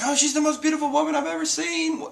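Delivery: high-pitched gushing awe; mock swooning. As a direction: gush it in a high, smitten voice. Voicing an awestruck Usopp's comment.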